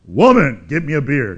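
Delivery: a loud, dismissive bark of woman, get me a beer; mock rude husband ordering a beer; rude demand. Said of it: "woman, is not like".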